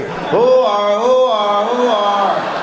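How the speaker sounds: long wailing mock siren by mouth; imitated police siren. Son leaning out of police car, wailing a mock siren. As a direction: hoot out a drawn-out human siren. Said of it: "lean out the window going".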